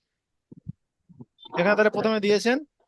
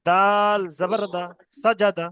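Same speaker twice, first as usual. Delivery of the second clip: slow drill with long-held letter names; deliberate, chant-like spelling aloud; spelling piece by piece; slower than usual. Shown as a demonstration. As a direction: stretch each letter, pause, then blend the syllables. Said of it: "কেটে কেটে বানান করবো".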